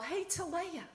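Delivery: high call across the campsite; mild mock yell to sister. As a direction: call her name across the fire. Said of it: "and I yell".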